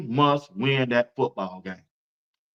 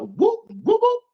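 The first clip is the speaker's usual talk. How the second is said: whoop sounds; 3 dB louder, slower than usual. Retold or imitated. Describing a juke move.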